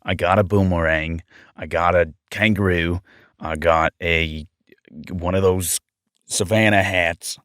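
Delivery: low-pitched Australian accent practice. Shown as a demonstration. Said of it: "I have to get Australian".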